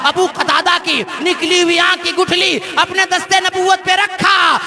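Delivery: loud, ringing sermon delivery, rising in pitch; impassioned preacher's cry; full-force preaching. Acted. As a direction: preach the miracle story to a big crowd, building to a drawn-out high climax.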